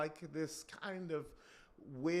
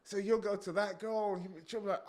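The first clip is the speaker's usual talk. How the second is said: usual speaking voice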